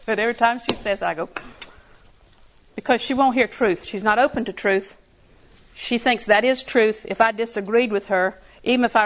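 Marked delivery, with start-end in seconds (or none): none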